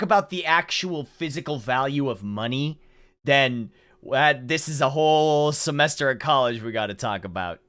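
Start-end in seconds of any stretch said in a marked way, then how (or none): none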